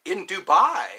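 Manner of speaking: real surprise at the hour; surprised. Real, not acted. Surprised at the time there.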